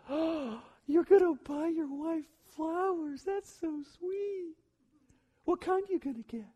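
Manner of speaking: put-on high-pitched, gushing teenage florist, drawn-out words; hammed-up girlish delight in a very high voice; very happy teenage girl. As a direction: squeal sweetly like a thrilled sixteen-year-old girl. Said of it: "very, very happy".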